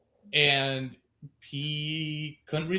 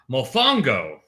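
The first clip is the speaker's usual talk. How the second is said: bad Puerto Rican accent; 5 dB louder, slower than usual. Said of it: "That's the worst accent".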